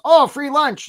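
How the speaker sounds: high, loud cartoon crab. Coach telling job seekers a crab story, high and loud. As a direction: squeal it like a greedy little crab. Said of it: "a crab comes along and says".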